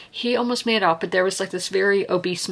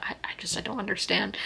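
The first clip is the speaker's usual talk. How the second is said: real choked whisper, barely voiced; emotional whisper; 2 semitones higher, 8 dB quieter, faster than usual. Real, not acted. Reading about 9/11 victims, overcome, whispering. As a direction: whisper it, too moved to speak aloud. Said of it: "I'm getting emotional thinking about it".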